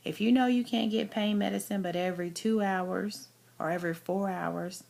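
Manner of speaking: ordinary talk, nothing marked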